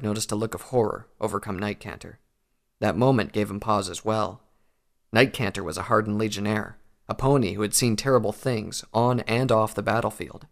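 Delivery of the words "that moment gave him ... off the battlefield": usual speaking voice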